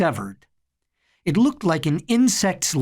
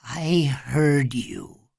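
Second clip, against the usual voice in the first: slow, trance-bound murmur; gravelly, slow-moving zombie-like reply; gravelly, hypnotized; much slower than usual. A narrator's reading. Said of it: "In his gravelly, slow-moving, hypnotized voice".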